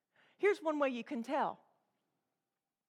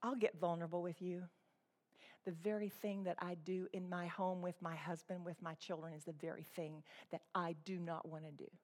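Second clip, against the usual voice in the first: much softer, lower and hushed, quickening; vulnerable confession; 7 semitones lower, 13 dB quieter, faster than usual. Shown as a demonstration. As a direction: confess it quietly, exposed and honest. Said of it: "That is what a teacher who's being vulnerable sounds like".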